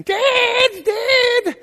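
put-on very high, loud panicked boy's call; shrieking child. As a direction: hit a pitch no boy should reach. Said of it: "reached the pitch I've never heard a boy".

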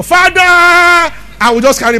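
drawn-out, high, loud cry of Father; mimicked prayer shout. Preacher mimics a brother praying on the field, mock shout. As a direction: bellow it like an overzealous prayer warrior. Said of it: "Some brother will just say".

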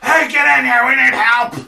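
high, loud goblin shout for help; goblin yell. Acted. GM voicing Krenko calling reinforcements, yelling loud and high. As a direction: holler across the room like a frantic goblin. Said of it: "he yells".

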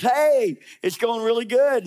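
high, loud, falsely cheery brush-off; cheery cover-up from a guilty king; breezy Saul. Retold or imitated. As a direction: play it off like all is fine. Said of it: "I can almost hear them having this dialogue".

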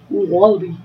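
low-pitched try at an Aussie accent; attempted Australian accent. Shown as a demonstration. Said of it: "Okay, let me try".

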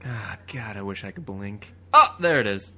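usual speaking voice

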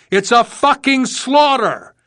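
high, slow, drawn-out shout; shouting back. A narrator's reading. Veteran answering a rookie under mortar fire, shouting back. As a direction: shout it back, stretching the words. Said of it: "Lunsford shouted back".

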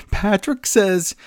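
ordinary talk

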